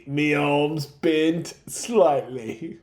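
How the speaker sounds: very slow, lightly Aussie drawl; a hint of an Australian droid, dragged out; droid's Australian voice. Droid complaining to the friends, in a light mock Australian accent. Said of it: "You're no longer Australian".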